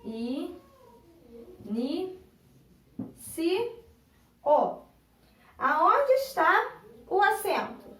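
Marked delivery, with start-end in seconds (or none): 0.0-4.8 "I, ni, si, o." in separate beats with pauses; syllable by syllable. Shown as a demonstration. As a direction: sound out each syllable of "I, ni, si, o.". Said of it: "separar essas duas palavrinhas em sílabas".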